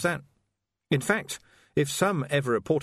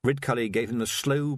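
plain reading voice

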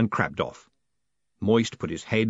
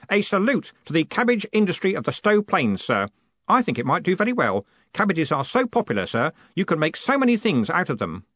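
put-on higher-pitched, keen young clerk; eager Stanley, proud; 8 semitones higher, 3 dB louder than usual. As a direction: enthuse proudly about cabbages.

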